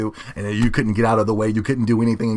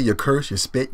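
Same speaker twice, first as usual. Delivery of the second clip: ordinary talk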